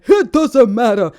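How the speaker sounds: loud, high, punchy catchphrase impression; big wrestler-style shout. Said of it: "Remember when [name] and [name]".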